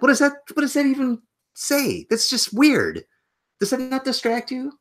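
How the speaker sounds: high-pitched incredulous rant; exasperated rant. Real, not acted. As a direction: vent your disbelief, way up high. Ranting about phony accents.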